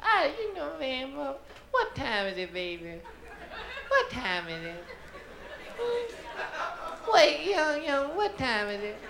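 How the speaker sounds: put-on high, wavering old-lady voice; senile grandma. Senile grandmother asks her grandson the time, high old voice. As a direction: ask like a confused old grandma. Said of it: "She say".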